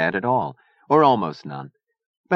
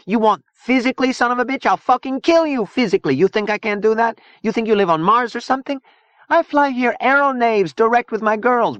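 high-pitched, raised-voice tirade; furious; 4 dB louder than usual. A narrator's reading. Zona threatening Gomi Boy at knifepoint, furious. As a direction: rage at them in threat after threat. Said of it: "snapping back into a furious version".

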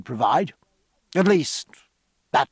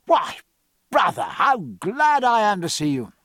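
hearty shout; 5 semitones higher than usual. A narrator's reading. Jack greeting his brother.

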